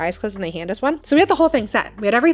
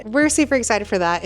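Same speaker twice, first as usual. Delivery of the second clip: usual speaking voice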